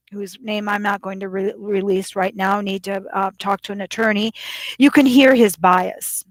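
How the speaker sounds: ordinary delivery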